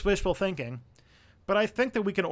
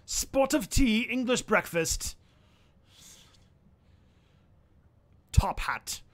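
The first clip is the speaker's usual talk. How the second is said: mock British accent; 6 semitones higher than usual. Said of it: "this dirty British accent I do".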